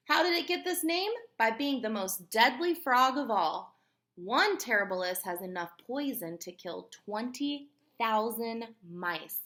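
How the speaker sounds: usual speaking voice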